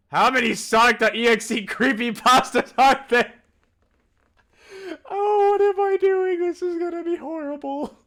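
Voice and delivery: high, loud hammed-up wail; mock despair. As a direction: cry out in comic dread. Dread at reading many creepypastas.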